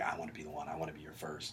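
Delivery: low, very soft imitation; hushed mock come-on; imitated seductive come-on.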